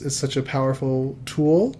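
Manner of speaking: ordinary delivery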